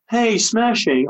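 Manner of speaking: a light, high-pitched swinging sixties exclamation; Austin Powers. Retold or imitated. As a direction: toss off a quick groovy sixties cheer. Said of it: "Austin Powers".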